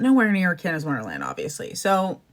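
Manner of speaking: ordinary delivery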